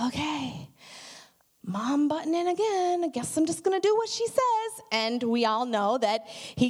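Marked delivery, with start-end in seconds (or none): 0.1-4.8 higher, lightly voiced, resigned Jesus; resigned son. Jesus answering his mother at the wedding: higher, mock resigned. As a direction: sigh along as the son giving in to mom. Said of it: "he's like".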